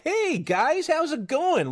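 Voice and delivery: put-on a perky American girl greeting the guys, lightly done; American accent. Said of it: "like, American accent".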